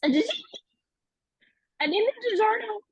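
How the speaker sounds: distressed babbling. Acted. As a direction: pour out half-sense words in distress. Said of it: "she's just in distress".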